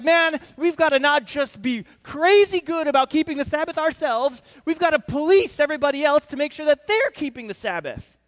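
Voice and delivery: loud, high, fired-up Pharisee rant; zealous shout. Acted. Pharisees rallying each other, loud fervent shouting. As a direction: bellow it like an overeager rule-keeper.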